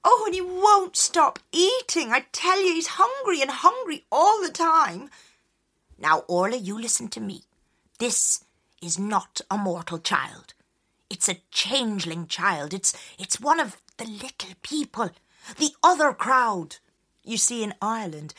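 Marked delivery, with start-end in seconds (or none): 0.0-5.1 high, louder worn-out mother; weary mother. Acted. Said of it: "Orla tells the story". 6.0-16.8 a hint of a grave old woman; stern wise woman. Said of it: "wise woman warns".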